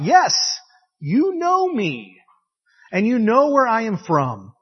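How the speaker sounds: high, drawn-out declaration; crying out. Acted. Jesus teaching in the temple courts, crying out. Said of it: "cried out".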